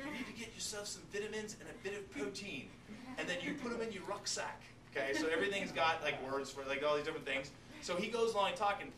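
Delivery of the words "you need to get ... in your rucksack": light imitation of a British accent, higher. Teacher imitating Bear Grylls's British accent for the class. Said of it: "He's got the accent".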